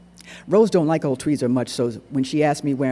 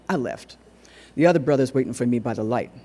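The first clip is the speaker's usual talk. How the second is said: ordinary delivery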